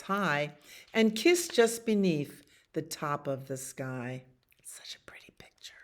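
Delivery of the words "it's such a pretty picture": whisper. Real, not acted. Parent whispering over the picture book. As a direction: breathe it out as a whisper.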